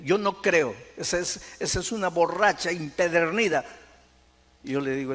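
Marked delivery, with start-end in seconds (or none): 0.0-3.6 loud, raised retold outburst; acted angry disbelief. Husband rejecting news that she quit drinking, acted angry disbelief. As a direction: scoff loudly as the disbelieving husband. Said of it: "se puso bravo".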